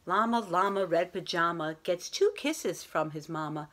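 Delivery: lilting rhyming read-aloud voice; bedtime story reading. A narrator's reading. Teacher reading a bedtime story to her class, gentle and lilting. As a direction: read it like a picture book at bedtime.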